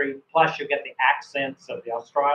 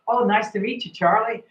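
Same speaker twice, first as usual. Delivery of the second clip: friendly encouraging reply; 9 semitones higher, 3 dB louder than usual. Shown as a demonstration. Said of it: "giving good encouragement back".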